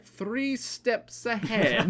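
slow, high, drawn-out smug line; smug villain catchphrase. Retold or imitated. Quoting a cartoon villain to a friend, slow smug gloat. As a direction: savour every word like a gloating villain.

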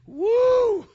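loud high whoop of craving. Retold or imitated. Preacher recalling his craving, loud whoop. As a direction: let out a wild whoop.

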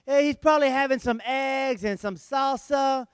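ordinary talk

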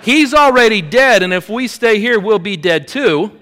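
loud, high mock-hysterical arguing; panicked insistence. Angie to Kim, urging escape from the car, panicked insistence.

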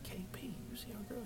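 hushed aside to a friend; very soft, so the girl won't hear; secretive whisper. Retold or imitated. Boy asking his friend about the beautiful girl, whisper.

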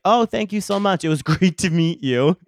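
high, loud, gushing thanks; mock-sweet farewell with a laugh; over-polite goodbye. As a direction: brush them off with hammed-up politeness. Retold polite brush-off to the guy.

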